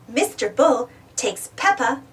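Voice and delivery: usual speaking voice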